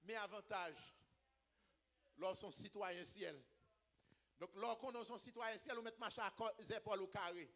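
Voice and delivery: quiet preacher's explanation; hushed preaching aside. Real, not acted. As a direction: bring it right down after the climax. Quiet teaching after the climax.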